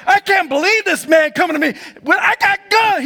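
high, loud incredulous protest; the gangsters protesting at the seven eleven; lightly voiced gangsters. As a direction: sound thrown by the tall man. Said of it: "they're like".

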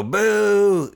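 loud, high mock boo from the crowd. Comic imitating the Orlando crowd, high and loud. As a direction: boo him like a heckling audience. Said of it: "you know, like that".

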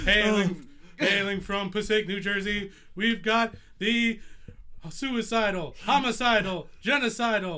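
loud booming fighter intro; wrestling ring announcer. Acted. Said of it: "I'm trying to do a wrestling promo".

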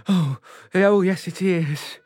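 loud, high panto shout; panto crowd callback. Acted. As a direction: call it out to the audience. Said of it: "panto call and response".

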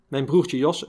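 usual speaking voice

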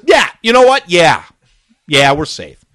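high, loud kid pilot impression; mock youthful emphatic reply; young pilot. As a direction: blurt it like a decisive youngster. Retold pilot finally answers insistently.

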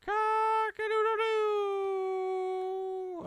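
high, drawn-out rooster crow. Pretend. Rooster crow wake-up call.

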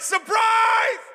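high, loud party-crowd yell; surprise shout. Retold or imitated. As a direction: yell it like guests springing out. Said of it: "it's like".

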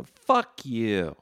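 loud, defiant retold snap at patrons; mock fuck you. Podcaster pretending to refuse patrons, loud mock snarl. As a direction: bark it at the fans, hammed-up.

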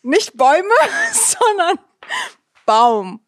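laughing, giggle on sondern; laughing correction. Real, not acted.

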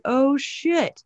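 slow, high, dismayed God realizing a mistake. Retold or imitated. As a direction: drawl it out like a stunned creator. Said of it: "he was like".

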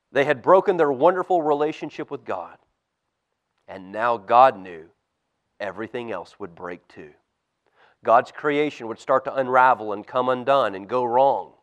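put-on lively storybook reading for kids; reading to children. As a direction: tell it like a bedtime Bible story.

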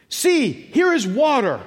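high, loud, slow exclaiming; excited shout. Retold or imitated. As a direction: call it out like a man spotting water. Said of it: "'cause he really was shouting it".

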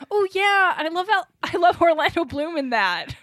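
high, loud gushing impression; imitating cohost. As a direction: mimic her excited mistake. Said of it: "you said something to the tune of".

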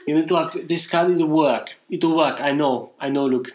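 earnest mock Italian accent; halting Italian-accented pleading. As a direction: imitate an Italian insisting in broken English. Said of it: "he's very Italian".

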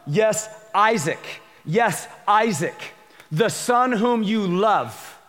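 slow, drawn-out Yes, Isaac; emphatic slow read. Acted. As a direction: lean on "Yes, Isaac" slowly. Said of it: "I think this is important".

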